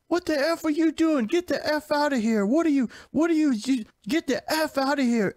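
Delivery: cranky cartoon guy yelling at the new accountant; angry game character. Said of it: "there's this guy sitting there and he's like".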